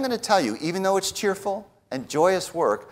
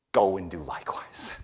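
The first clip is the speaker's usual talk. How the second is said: hushed, unvoiced whisper of the command; 10 semitones lower, 8 dB quieter than usual. Preacher whispers Jesus' command to the congregation, hushed. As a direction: breathe it out as a whisper.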